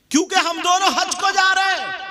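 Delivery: high, loud proud declaration; boss's announcement. Retold or imitated. Boss announcing pilgrimage.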